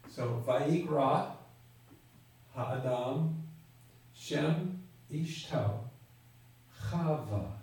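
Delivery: "Chava" set apart and stressed, very slow; emphasized slow reading. Shown as a demonstration. Teacher rereads the Hebrew, stressing "Chava" very slowly. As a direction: read it word by word, landing on "Chava". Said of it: "I want to emphasize two words".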